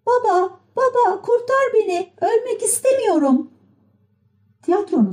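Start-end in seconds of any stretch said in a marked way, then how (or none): 0.1-3.5 very high puppet cry for help; Pinokyo. A narrator's reading. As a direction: plead for life in a shrieky pitch, without extra volume. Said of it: "Pinokyo avaz avaz haykırıyordu". (tiz sesle imdat haykırışı)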